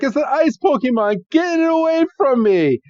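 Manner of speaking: put-on high, panicky girl's shriek; freaked-out shriek. Iris panics at ice Pokemon.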